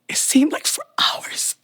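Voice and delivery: whispered, overcome by the memory; hushed. Real, not acted. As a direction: breathe it out in awe.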